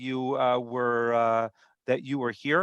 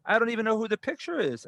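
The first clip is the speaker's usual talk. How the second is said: much higher-pitched mock complaint; retold indignant protest; 9 semitones higher than usual. Customer objects to the new bill, retold indignation. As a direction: imitate a customer in a huff.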